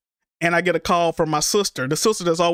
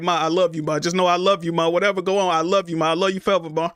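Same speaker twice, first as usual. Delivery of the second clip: ordinary talk, nothing marked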